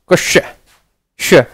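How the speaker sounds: कुश then श, each sound said slowly and apart; sound demo. Shown as a demonstration. Teacher demonstrating how कुश blends into श. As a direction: break क्ष into कुश and श for the class. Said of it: "देखो श".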